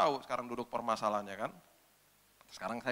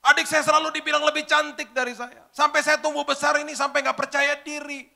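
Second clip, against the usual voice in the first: crying; 12 semitones higher, 8 dB louder than usual. Retold or imitated. Girl suddenly breaks down about her father, crying loudly. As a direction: sob out the grudge. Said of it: "Sambil nangis-nangis".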